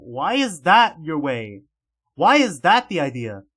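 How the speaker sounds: loud, disbelieving outburst; loud incredulous complaint; exasperated yell. Acted. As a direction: shout it in exasperation. Reacting to her wild plan.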